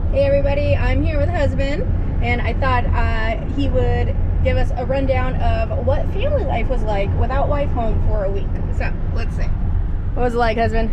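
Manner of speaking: vlog host. Real, not acted. Interview intro to camera.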